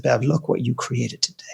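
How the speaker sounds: hushed, moved whisper to her; soft awed whisper. Retold or imitated. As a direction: breathe it softly so only she hears. Quiet word to Bev after talk.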